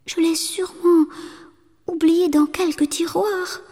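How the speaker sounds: a timid wife's murmur; murmured excuse. A narrator's reading. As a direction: murmur it, looking away. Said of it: "murmura sa jeune épouse".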